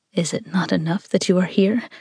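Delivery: usual speaking voice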